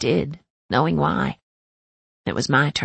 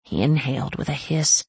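usual speaking voice

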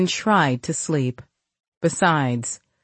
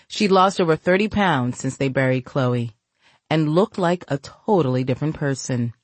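plain reading voice

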